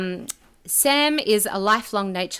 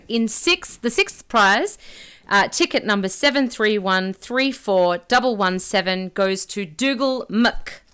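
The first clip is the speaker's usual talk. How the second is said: booming prize announcer; 4 dB louder than usual. Acted. Drum roll, raffle winner announced.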